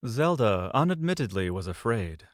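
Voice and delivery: ordinary talk, nothing marked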